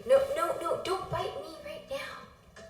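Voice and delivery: mild playful telling-off of a pet; scolding the cat. Real, not acted. Streamer telling her biting cat off, high playful scold.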